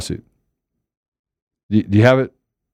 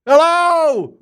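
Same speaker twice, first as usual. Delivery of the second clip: mock shouted greeting; 12 semitones higher, much slower than usual. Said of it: "came flying around and said".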